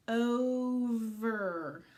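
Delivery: "Over" stretched out as one joined word; slow blending. Shown as a demonstration. Teacher blending the word for children, drawn out. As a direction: glide slowly through every sound of "Over". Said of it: "Try and read this word".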